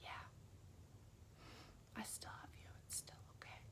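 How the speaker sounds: hushed whisper back; whispering back to God; whispered reply. Retold or imitated. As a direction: answer in a soft whisper. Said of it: "because he whispered that".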